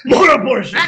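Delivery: shrill animal cry; animal impressions. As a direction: yelp it like a beast. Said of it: "I'm making animal noises right now".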